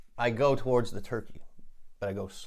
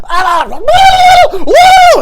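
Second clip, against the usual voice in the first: very high, very loud woo; battle shriek; 21 dB louder, much slower than usual. Acted. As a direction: scream out as you brawl. Battle cry mid fight.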